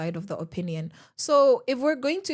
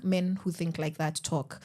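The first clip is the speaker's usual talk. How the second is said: ordinary talk, nothing marked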